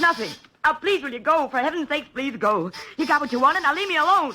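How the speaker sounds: high, urgent begging; frightened pleading. Acted. Scared woman begs the detective to leave her alone.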